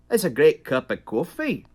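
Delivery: lilting Liverpool accent, almost sung; lilting Scouse sing-song. Shown as a demonstration. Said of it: "or like the Liverpool thing".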